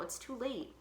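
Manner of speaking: ordinary delivery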